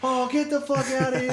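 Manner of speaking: high mock crowd yell; a hint of an outraged crowd; imitating angry crowd. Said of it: "everyone's like".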